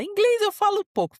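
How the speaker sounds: put-on high, loud child's reply; imitating young son. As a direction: pipe up like a little kid. Son's answer acted out.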